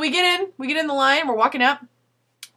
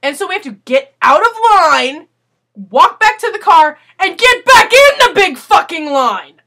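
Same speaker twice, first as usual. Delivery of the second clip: exasperated retelling; 5 semitones higher, 8 dB louder than usual. Retold or imitated. Annoyed retelling of the long line.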